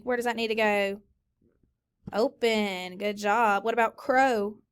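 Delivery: ordinary talk, nothing marked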